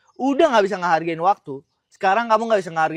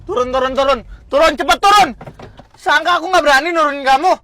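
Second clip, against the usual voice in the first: loud, raised-pitch shouting back; heated, high and forceful; angry retort; 5 semitones higher, 8 dB louder than usual. Acted. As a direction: fire it back loudly.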